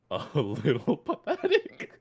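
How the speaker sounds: slow, laughing through the words. Real, not acted. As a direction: laugh at how weak the character is. Reading weak character HP.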